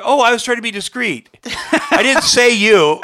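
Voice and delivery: high, loud mock pleading; defensive protest. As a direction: protest your innocence in a hammed-up high voice. Acted defensive excuse to scolding journalist.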